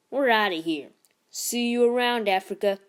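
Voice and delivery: bully sneering at T'Challa as he leaves, loudly; cocky bully. Acted. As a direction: toss it over your shoulder with swagger. Tall boy leaving, taunting.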